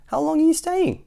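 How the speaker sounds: high-pitched character question; anxious fussy question. A narrator's reading. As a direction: ask it high, dreading the answer. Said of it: "asked Mister Fussy".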